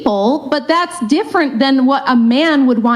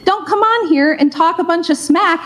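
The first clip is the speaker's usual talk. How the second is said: ordinary talk, nothing marked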